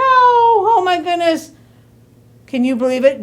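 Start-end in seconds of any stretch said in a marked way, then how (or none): 0.0-1.5 high, loud exclamation of shock; amazed. A narrator's reading. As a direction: gasp out your amazement. The cow comes out.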